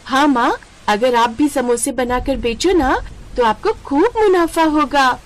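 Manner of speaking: put-on excited kid urging his mother; a child pleading with his mom. Child voice in story.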